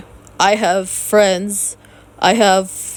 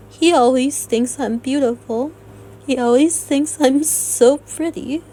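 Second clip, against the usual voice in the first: holding back tears while praising him; near tears; 5 semitones higher than usual. Real, not acted. Real near-crying voice about her boyfriend. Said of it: "I'm sounding like I'm crying right now".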